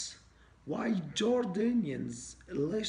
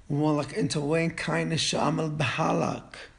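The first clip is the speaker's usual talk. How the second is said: angry yell; 3 semitones higher, 10 dB louder than usual. Acted. Boss yells at the latecomer.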